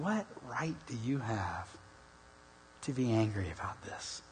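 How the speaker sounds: God's reply, whispered. Retold or imitated. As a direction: breathe the question with no voice at all. God answering, voiced softly.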